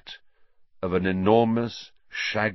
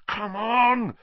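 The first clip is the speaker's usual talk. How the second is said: growled; 11 semitones higher than usual. A narrator's reading. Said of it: "he growled at his nimbus".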